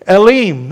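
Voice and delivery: Elim said as eh-LEEM; Hebrew word Elim. Shown as a demonstration. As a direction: sound out Elim clearly for the congregation. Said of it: "Everybody say Elim".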